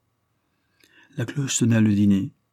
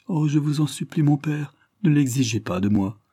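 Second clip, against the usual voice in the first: sobbing plea; 7 semitones higher than usual. A narrator's reading. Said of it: "s'écria Maria en sanglotant".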